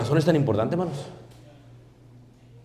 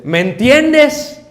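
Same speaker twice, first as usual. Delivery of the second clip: much higher, louder, slow insistent question; imitating wife; 11 semitones higher, 8 dB louder, much slower than usual. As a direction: draw the question out at the husband.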